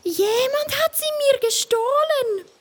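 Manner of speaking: high, plaintive little squirrel voice; a small squirrel wailing its nuts are gone; squirrel complaining. A narrator's reading. Squirrel tells everyone openly, high and plaintive. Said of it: "Das Hörnchen sagt's ganz unverhohlen".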